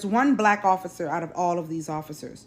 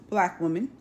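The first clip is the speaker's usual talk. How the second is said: ordinary talk, nothing marked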